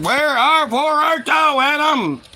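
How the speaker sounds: hammed-up divine summons, losing patience; angry God calling. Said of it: "God's getting mad".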